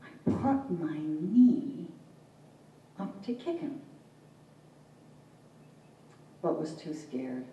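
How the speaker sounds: halting verse with long pauses; poem reading. Acted. Poet recalling a failed kick at her father, halting poetic reading.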